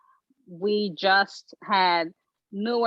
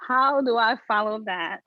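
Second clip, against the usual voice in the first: normal talking voice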